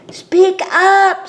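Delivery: a loud, high call from the crowd. Acted. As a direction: shout it from the back. Someone calls to quiet reader.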